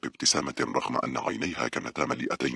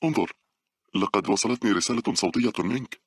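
higher, eager announcement; excited; 8 semitones higher than usual. A narrator's reading. Paula shows Carlos the new message, excited. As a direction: share the news with mild excitement. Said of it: "قالت باولا بحماس".

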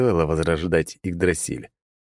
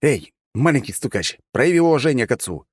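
higher-pitched telling-off; scolding; 7 semitones higher than usual. A narrator's reading. Hero ordering dendroid to respect his father, scolding. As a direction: scold the little snitch, pitch raised.

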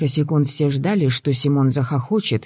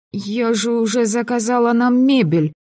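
дрожащим голосом; 9 semitones higher than usual. A narrator's reading. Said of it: "У Мари дрогнул голос".

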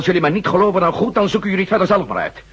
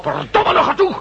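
furious shout, pitch leaping high; angry outburst; 12 semitones higher than usual. Acted. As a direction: explode in rage at the liar. Furious outburst at lying Fields. (woedende uitroep)

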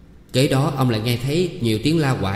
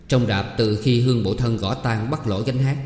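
plain reading voice

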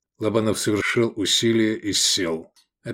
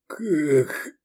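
higher word let out on a breath; exhaled word; 5 semitones higher than usual. A narrator's reading. Drunk rescuer reacting to the freed man, exhaled. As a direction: breathe the question out. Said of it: "выдохнул Шавкат".

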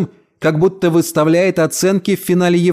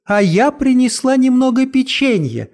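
joyful; 9 semitones higher than usual. A narrator's reading. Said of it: "радостно отозвалась Мот".

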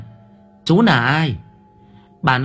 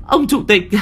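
put-on terrified old woman pleading; pleading shriek; 11 semitones higher than usual. A narrator's reading. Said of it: "Bà cụ Sâm rú lên".

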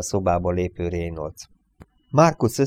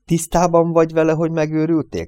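high, louder, incredulous challenge; appalled outburst; 9 semitones higher, 5 dB louder, faster than usual. A narrator's reading. Marcus asks Reynolds if he's mad, aghast and loud. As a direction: accuse him of madness, voice pitched right up.

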